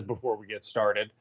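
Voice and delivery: lightly imitating Armando's lead-in; mock Armando. Co-host teasing Armando by imitating his opener, lightly mocking. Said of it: "or I guess before Armando says".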